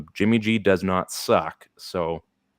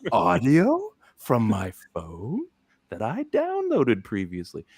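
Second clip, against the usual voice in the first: mock confused listener; 11 semitones higher, slower than usual.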